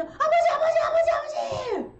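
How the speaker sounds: high, loud frantic cries, half laughing; desperate repeated shout. Retold or imitated. Son calling frantically beside fallen father, desperate shout. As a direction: call again and again in panic.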